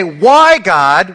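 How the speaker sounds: high, loud plea; crying out to God. Retold or imitated. Sufferer crying out to God, high and loud. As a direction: call out to God in hurt. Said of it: "when we say".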